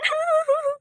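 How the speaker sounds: high-pitched jeering cackle; jeering laugh. Acted. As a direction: laugh high at your own insult. Laughs after calling her a witch. (ऊँची खिल्ली उड़ाती हँसी)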